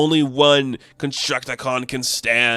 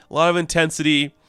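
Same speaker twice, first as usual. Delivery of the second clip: normal talking voice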